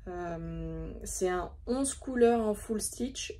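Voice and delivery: usual speaking voice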